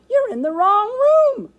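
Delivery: very high, loud squeak of alarm; squeaking hamster. A narrator's reading. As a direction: squeak up urgently as a tiny hamster. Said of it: "I squeaked".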